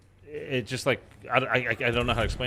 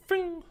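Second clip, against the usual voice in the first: high zippy whoosh sound; mock whoosh noise; whooshing fing. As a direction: zip past like a falling body. Sound of falling past.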